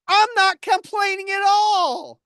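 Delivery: high, slow, drawn-out whine; whiny kid. Acted. Accused complainer denying it to a partner, whiny. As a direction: protest like a child whining for candy. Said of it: "your mouth sounds like a kid whining for candy".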